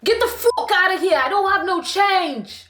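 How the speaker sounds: bad Canadian-turned-British accent; botched Canadian accent. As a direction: attempt a strong Canadian accent that slips into UK, drawing out the last words. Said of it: "that sounded like a U K person".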